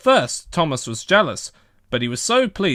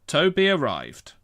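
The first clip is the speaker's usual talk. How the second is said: ordinary delivery, nothing marked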